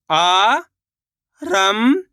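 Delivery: आरंभ stretched very slowly, rising at the end; slow drawn-out reading. Shown as a demonstration. Teacher sounding out आरंभ for pupils, very slow and rising. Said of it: "शब्द को आपको खुद से पढ़ना है".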